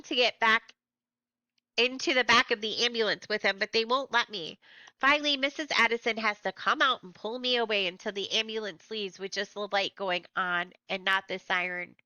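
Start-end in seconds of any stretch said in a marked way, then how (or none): none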